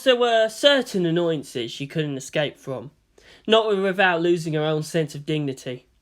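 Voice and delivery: ordinary delivery, nothing marked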